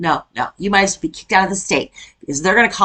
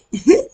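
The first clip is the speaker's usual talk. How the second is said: high burst of laughter; 12 semitones higher than usual. Real, not acted. Laughs at friend's phrase.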